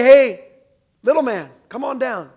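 a loud, lightly mocking "hey" to a short man; mocking call. What the crowd might shout at the short man, lightly mocking. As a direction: jeer lightly like the crowd would. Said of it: "He doesn't say".

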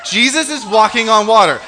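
loud, high, laughing amazement; amazed exclamation. Acted. Preacher reacting to the miracle for the congregation, amazed. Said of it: "It's crazy. Oh my gosh".

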